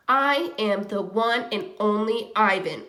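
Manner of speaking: ordinary delivery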